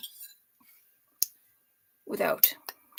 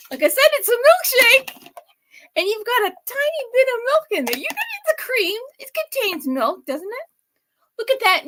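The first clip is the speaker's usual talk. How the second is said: pitched-up giddy host talk; bubbly and much higher, over the drink; high excited chatter; 11 semitones higher, 4 dB louder than usual. Real, not acted.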